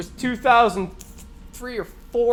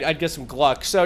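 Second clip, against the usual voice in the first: ordinary talk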